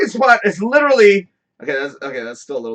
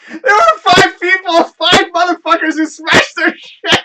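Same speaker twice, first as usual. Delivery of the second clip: high, loud, slowed words shaking with laughter; cracking up as you say it; laughing hard while talking; 10 semitones higher, 6 dB louder, slower than usual. Real, not acted. Telling viewers about customers wrecking cars, loud laughing. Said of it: "That's why I'm laughing so hard".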